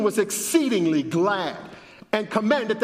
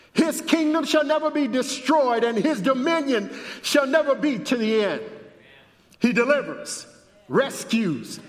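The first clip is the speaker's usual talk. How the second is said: high, ringing scripture proclamation; raised pulpit voice, declaiming each phrase; preacher proclaiming a decree; 6 semitones higher than usual. A narrator's reading. Reads the king's decree aloud.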